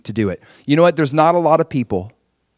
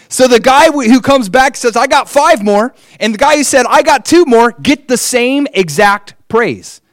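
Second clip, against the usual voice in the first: higher and louder, eager; excited; 10 semitones higher, 5 dB louder than usual. Real, not acted. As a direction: pump up the story. Excited telling of praise.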